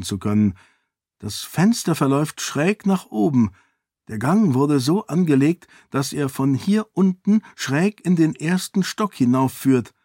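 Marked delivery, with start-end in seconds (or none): 1.2-9.9 higher-pitched, eager explanation; excited lady sleuth. Acted. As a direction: reason it out aloud as a keen lady. Lady explaining the secret passage.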